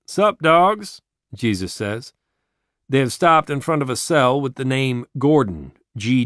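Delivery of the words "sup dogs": put-on high, loud cool-kid greeting; casual street greeting. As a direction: toss it off like a hip kid.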